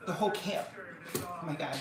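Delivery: normal talking voice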